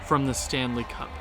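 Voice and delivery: low, soft history-recap voice; hushed, wistful looking back; reflective documentary narration. Acted. Retells the franchise history.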